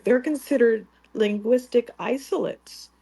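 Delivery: ordinary talk, nothing marked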